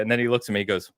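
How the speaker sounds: ordinary delivery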